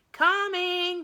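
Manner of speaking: high, loud shout back. A narrator's reading. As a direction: yell it out the window. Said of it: "she shouted".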